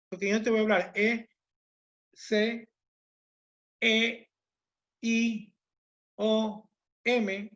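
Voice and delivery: E, C, E, I, O, M as separate letters with pauses; disjointed letters. Shown as a demonstration. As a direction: spell the letters one by one, each its own beat. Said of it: "¿Qué te estoy diciendo?".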